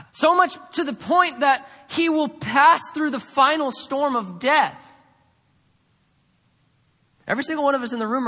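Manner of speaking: loud, high-pitched pulpit proclamation; forceful preaching. Real, not acted. Preacher proclaiming life through death to the congregation, forceful preaching.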